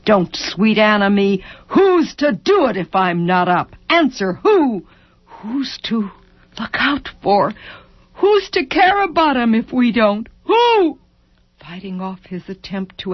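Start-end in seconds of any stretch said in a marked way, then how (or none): 0.1-11.0 anguished questions climbing to a high cry; distraught demand. A narrator's reading. Wife confronting husband over the children, near hysterical. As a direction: build from angry rebuke to desperate demand. Wife's quarrel reaches breaking point.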